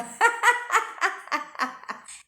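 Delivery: risada alta e aguda, espontânea; gargalhada. Real, not acted. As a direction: ri alto da própria piada. Laughs after calling herself a saint. (gargalhada aguda e solta)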